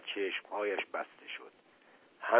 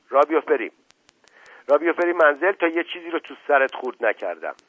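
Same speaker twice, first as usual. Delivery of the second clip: loud, higher, hurried threat; threatening order; 5 semitones higher, 6 dB louder, faster than usual. Acted. Mother ordering daughter home, angry threat. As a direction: order her out angrily.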